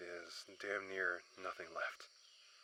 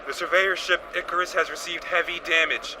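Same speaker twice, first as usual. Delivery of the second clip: loud distress call over a crackling radio, higher; shouted radio mayday; 10 semitones higher, 16 dB louder than usual. Acted. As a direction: yell the mayday into the comms over the noise. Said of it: "as the radio transmission said".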